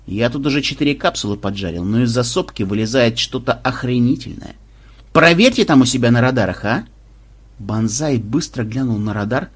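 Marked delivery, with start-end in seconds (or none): none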